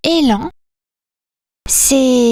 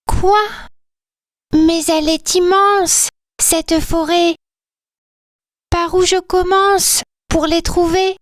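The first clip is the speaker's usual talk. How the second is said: higher, startled and a bit overwhelmed; little elf gasping at how big the forest is; high-voiced elf, surprised; 6 semitones higher than usual.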